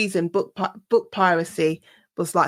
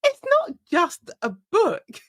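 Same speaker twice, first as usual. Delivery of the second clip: infuriated retort; 5 semitones higher than usual. Acted. Author answering someone who dismissed piracy, indignant. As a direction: protest with wounded indignation. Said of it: "And the infuriating thing about, um, book piracy".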